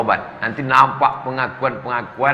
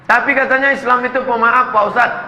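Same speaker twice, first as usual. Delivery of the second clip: higher, challenging question; objecting congregant; 8 semitones higher, 4 dB louder than usual. Acted. Congregant challenging the ustadz, higher pitched. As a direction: push back at the preacher, higher.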